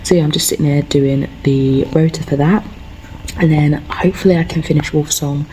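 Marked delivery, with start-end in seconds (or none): none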